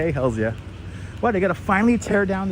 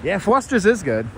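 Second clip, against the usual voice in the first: ordinary talk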